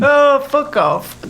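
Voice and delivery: mock outrage. Real, not acted.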